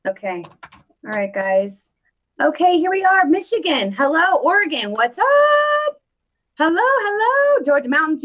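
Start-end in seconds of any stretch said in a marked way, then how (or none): 2.4-8.2 excited. Real, not acted. Greeting viewers by their states.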